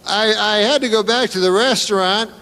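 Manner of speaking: naive suspect. Retold or imitated. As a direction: tell it like an innocent dope. Acting the suspect's story.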